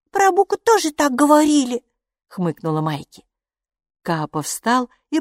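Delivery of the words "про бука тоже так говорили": put-on high, louder little-girl voice; little girl's retort. A narrator's reading. Maiki argues back about the creatures, high child's retort. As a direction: pitch it up like a small child arguing.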